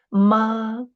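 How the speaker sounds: "Ma" held high and level; first tone. Shown as a demonstration. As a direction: sustain "Ma" on one high note. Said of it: "the first tone".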